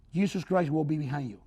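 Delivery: ordinary talk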